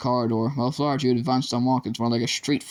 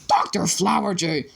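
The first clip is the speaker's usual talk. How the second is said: scream; 6 semitones higher than usual. A narrator's reading. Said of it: "Doctor Dart screamed".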